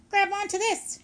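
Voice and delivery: urgent call. A narrator's reading. Robot urging rescue from pond.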